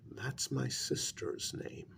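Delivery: ordinary delivery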